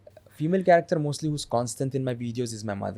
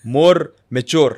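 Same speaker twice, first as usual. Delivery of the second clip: put-on broken-English father character accent; lightly voiced old father with a heavy accent; Abu Zubair accent; 8 dB louder, much slower than usual. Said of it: "He has an accent".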